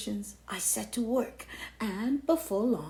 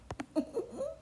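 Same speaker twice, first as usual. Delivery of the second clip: smug laugh; 6 dB quieter than usual. Acted. Cheese laughs at self.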